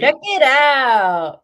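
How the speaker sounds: loud, slow, drawn-out mock catchphrase; playful echo. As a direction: stretch it out like a hammed-up announcer. Playfully echoes Siri's sign-off.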